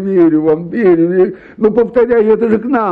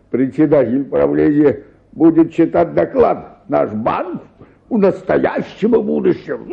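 usual speaking voice

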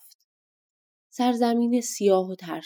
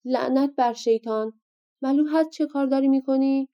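a mildly raised, higher angry outburst; angry shout; 6 semitones higher than usual. Acted. Giant jolted from sleep, cursing his mother: mild angry shout. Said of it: "غول از خواب پرید و فریاد کشید".

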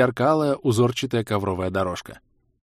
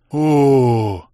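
long, higher drawn-out oh; 3 semitones higher, 6 dB louder than usual. A narrator's reading. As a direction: stretch the oh out in awe. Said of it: "протянул игрок".

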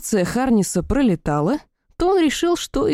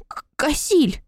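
stammering nervous call; 7 semitones higher, slower than usual. A narrator's reading. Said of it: "нервно позвала я".

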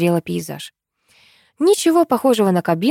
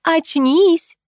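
high, insistent wake-up cry; acted urgent shout, moderate force; rousing call; 10 semitones higher than usual. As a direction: call high to jolt a sleeper awake. Shaking a sleeper awake.

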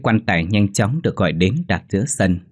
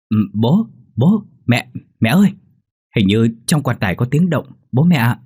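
frightened call; 4 semitones higher, 5 dB louder than usual. Acted. Boy hears knocking in coffin.

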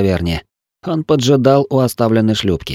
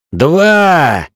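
high, loud hurried call; hasty shout; 8 semitones higher, 4 dB louder than usual. A narrator's reading. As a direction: blurt the number quickly. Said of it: "поспешно бросил я".

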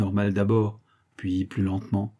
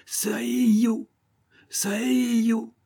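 very high, drawn-out squawk; high sustained bird cry; parrot crying out; 11 semitones higher than usual. A narrator's reading. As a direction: screech it like a parrot, stretching the vowels. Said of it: "papa de crier à son tour".

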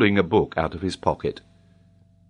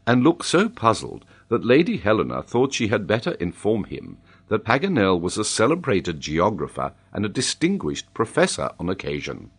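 plain reading voice

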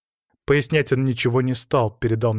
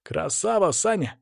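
higher-pitched mock call from the crowd; admiring call-out, held back in volume; approving shout; 6 semitones higher than usual. A narrator's reading. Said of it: "одобрительно крикнул".

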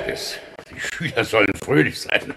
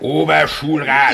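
indignant correction; 5 semitones higher, 8 dB louder than usual. Acted. Official corrects the teacher's wrong title, loud indignant snap.

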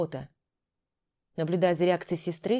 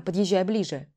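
plain reading voice

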